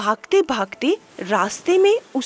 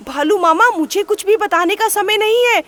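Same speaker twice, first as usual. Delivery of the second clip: higher, louder frantic pleading; panicked rabbit; 7 semitones higher, 3 dB louder than usual. Acted. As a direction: urge him to run, breathless with fear. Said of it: "खरगोश तुरंत बोला".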